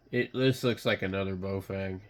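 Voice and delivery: ordinary delivery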